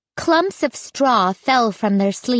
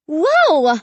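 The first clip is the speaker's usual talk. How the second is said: high startled exclamation; crying out; 6 semitones higher, 4 dB louder than usual. A narrator's reading. Child seeing scarecrows jump back, startled cry. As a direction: burst out in amazement. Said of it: "I cried".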